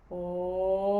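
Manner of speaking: a steady low O sliding through the range; sung O slide. Shown as a demonstration. Teacher leads the group O slide, smooth sustained tone. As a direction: sustain the O and let it glide smoothly. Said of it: "begin the O slide".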